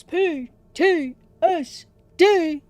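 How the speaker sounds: ordinary delivery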